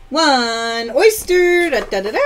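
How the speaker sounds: drawn-out singsong announcement; playful prize call. Real, not acted. Host reveals an oyster prize on a live game, playful. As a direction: stretch it out, then a little fanfare.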